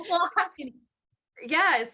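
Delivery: high voice breaking up with real laughter; laughing hard. Real, not acted. Guest recognising her own burnout story, laughing hard. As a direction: talk through helpless laughter. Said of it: "I'm laughing so hard".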